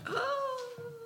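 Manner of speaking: high, breaking gasp of wonder; choked-up high "oh"; awestruck. Retold or imitated. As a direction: gasp as if seeing a ghost. Thomas overwhelmed seeing Jesus.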